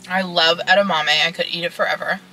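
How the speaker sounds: chewing while talking; eating salad while speaking; mouth full. Real, not acted. Seen 5: Closed mouth, eating salad with fork throughout; refs show no food.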